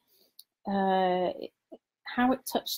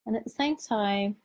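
guided meditation. Acted. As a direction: lead them gently into calm, unhurried.

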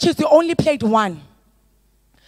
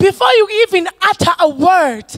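fervent shouted preaching; preaching at full force; 5 semitones higher than usual. Acted. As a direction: build to a roaring sermon climax. Preaching climax over music.

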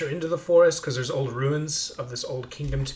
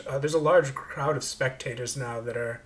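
usual speaking voice

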